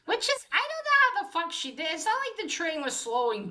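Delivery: high, fast burst of disbelief; real rapid, high-pitched bafflement; incredulous. Real, not acted. Disbelief at the graceful jump.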